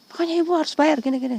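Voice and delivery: high, loud, rapid mock badgering; imitating pushy collector. Debt collector insists she must pay, mock pushy.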